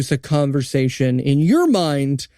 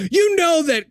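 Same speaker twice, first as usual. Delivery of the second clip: retold screaming; 10 semitones higher than usual. Retelling the characters' screaming match, mild mock yell. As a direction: imitate someone screaming in an argument. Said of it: "they immediately start screaming at each other".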